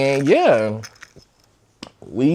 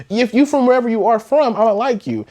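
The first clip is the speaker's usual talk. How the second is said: excited reassurance; 10 semitones higher, 4 dB louder than usual. Retold or imitated. Retold pep talk to boyfriend.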